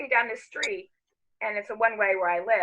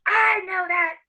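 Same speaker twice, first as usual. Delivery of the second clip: high, loud irritated retort; mad date snapping; 10 semitones higher, 5 dB louder, slower than usual. Retold or imitated. Date told he passed her house, angry retort. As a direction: snap back at full exasperation. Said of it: "he's all mad".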